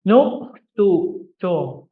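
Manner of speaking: slow model word. Shown as a demonstration. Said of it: "you're learning something here".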